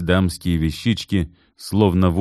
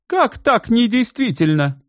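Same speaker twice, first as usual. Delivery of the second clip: outraged disbelief. A narrator's reading. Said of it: "вскочил Верещагин".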